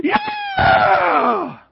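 high, drawn-out holler; cheering teammates. Retold or imitated. Teammates cheering the tackle, long screamed cheer. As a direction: imitate a team screaming in triumph.

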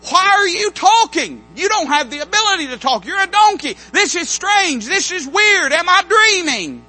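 amazed disbelief. Pretend. Balaam staring at his talking donkey, astonished.

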